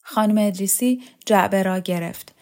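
ordinary delivery, nothing marked

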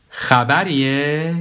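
long drawn-out, suggestive question; insinuating question. Acted. Ex-wife stops on Niloufar and asks, insinuating question. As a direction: stretch it out very slowly with suspicion.

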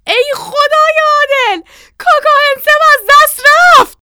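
very high, loud wail of horror; anguished scream. A narrator's reading. Mother sees Kaka fall into well, screaming. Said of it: "مامان فریاد زد".

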